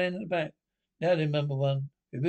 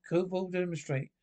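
ordinary talk, nothing marked

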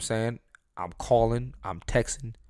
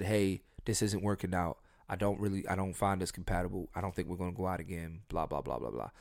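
calm breakup line; 4 semitones lower, 8 dB quieter than usual. Retold or imitated. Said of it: "I should have said".

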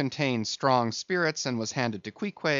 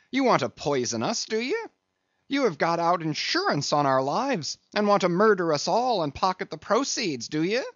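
high, heated accusation rising at the end; indignant, pitched-up challenge; angry accusation; 6 semitones higher, 3 dB louder than usual. A narrator's reading. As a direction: accuse them of a murderous plot. Stubb accuses steward of poisoning them.

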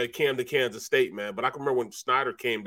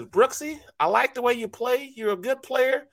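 high-pitched coach, matter-of-fact; 11 semitones higher than usual. Retold or imitated. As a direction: deliver bad news high and light. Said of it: "he says".

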